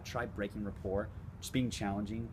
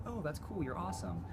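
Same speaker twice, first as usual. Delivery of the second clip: much higher, mock fawning; mock gushing praise; 10 semitones higher than usual. Coach mocking a fawning validation seeker, much higher. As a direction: ham up the people-pleasing.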